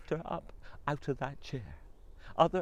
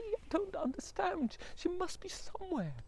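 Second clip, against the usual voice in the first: high husband voice, puzzled; bewildered husband. Acted. Husband puzzling over his missing wife, high. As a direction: wonder aloud where she went.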